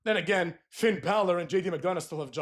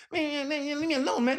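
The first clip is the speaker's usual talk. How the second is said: high pouty whine, mocking him; Jey Uso; 7 semitones higher than usual. Wrestler brushing off the interviewer, mock pouting. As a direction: whine it like a sulking kid. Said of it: "he's having that attitude".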